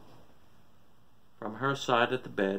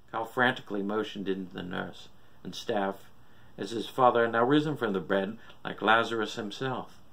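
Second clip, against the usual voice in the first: ordinary delivery, nothing marked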